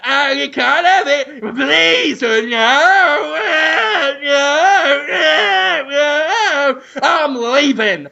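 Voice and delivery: high whiny pleading then flounce; huffy whining exit. Acted. Buyer whines at the agent and flounces off. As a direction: whine and storm off.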